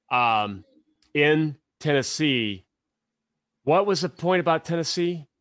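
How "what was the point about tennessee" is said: normal talking voice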